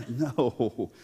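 normal talking voice

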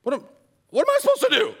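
high, frantic king in a panic; panicked king. Retold or imitated. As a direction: squeak and sputter like a cornered king. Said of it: "reading this letter and going".

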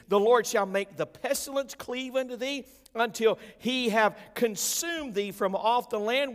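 booming scripture reading. Acted.

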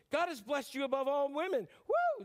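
preacher whooping the blessing for the crowd; joyful exclamation. Acted. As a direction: cheer the blessing then whoop. Repeats Elizabeth's joyful blessing.

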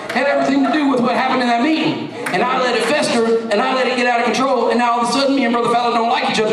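normal talking voice

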